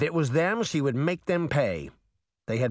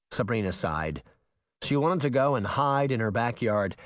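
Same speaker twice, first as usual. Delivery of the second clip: ordinary delivery, nothing marked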